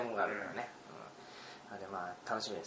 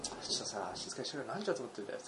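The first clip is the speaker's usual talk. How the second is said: low-volume, weary telling-off in imitation; imitated hushed grumble at noisy students; retold neighbour's complaint; 3 semitones lower, 11 dB quieter, faster than usual. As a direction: scold softly, playing the annoyed neighbour. Neighbour complaining about noise, retold.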